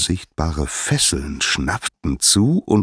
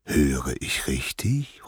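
slow, hushed disbelief; put-on affront, softly incredulous; feigned indignation; 3 semitones lower, 6 dB quieter, slower than usual. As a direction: draw it out as if offended. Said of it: "heuchelte Dionysos Empörung".

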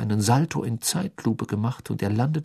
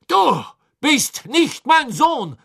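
hoch, laut und langsam, hart abweisend; verstoß ihn mit kaltem Zorn; Crouch bellt; 7 dB louder, slower than usual. A narrator's reading. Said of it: "bellte Crouch". (laut und langsam, schroff bellend)

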